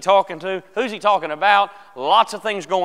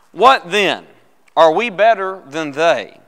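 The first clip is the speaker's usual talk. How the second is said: loud, measured public Bible reading, falling at the end; scripture reading; 7 dB louder, slower than usual. Real, not acted. Pastor begins reading Romans to the class, loud and deliberate. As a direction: read it out firmly and slowly to the room. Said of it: "Let's begin reading it".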